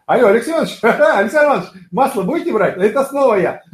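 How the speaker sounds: high, cheery, laughing sales call; bright smiling voice. Shown as a demonstration. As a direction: beam and giggle through it. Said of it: "Яркая улыбка". (ярко, с улыбкой и смехом)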